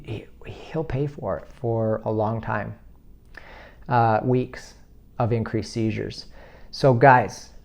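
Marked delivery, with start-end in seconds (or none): 0.0-6.3 whispered, much softer, slowed; hushed whisper. Real, not acted. Telling viewers about his son, hushed whisper. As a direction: drop to a grim whisper. Grave aside about son's suffering.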